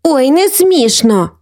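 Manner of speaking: put-on high, drawn-out sulky girl; huffy little sister, stretched out; offended. A narrator's reading. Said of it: "образилася Софійка". (ображено, по-дитячому)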